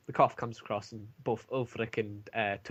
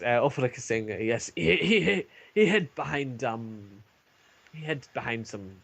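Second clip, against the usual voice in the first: ordinary talk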